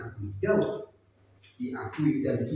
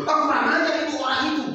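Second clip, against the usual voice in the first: angry king; 12 semitones higher, 3 dB louder, faster than usual. Acted. King outraged at rich man.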